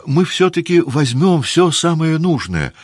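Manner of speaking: eager insistence. A narrator's reading.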